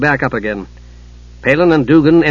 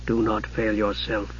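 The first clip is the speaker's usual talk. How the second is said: low, slow, very soft reproach; quiet, menacing sorcerer uncle, low and unhurried; cold mage; 5 semitones lower, 12 dB quieter than usual. A narrator's reading. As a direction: chide softly, stretching each word. Raistlin voiced, warning his nephew.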